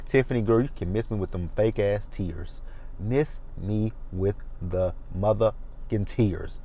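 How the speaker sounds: dismissive retort. Retold or imitated. Retorting to crying castmate.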